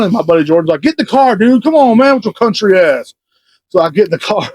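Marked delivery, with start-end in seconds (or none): 0.8-3.1 high-pitched imitated hollering to come along; urgent urging. Imitating buddy ordering him into the car, urgent.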